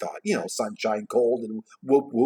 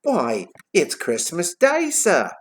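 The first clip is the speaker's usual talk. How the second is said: put-on slow British boy's accent; changed accent; 6 semitones higher, 4 dB louder, slower than usual. A narrator's reading. Boy amazed at the question, British accent. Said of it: "Notice my accent's changed".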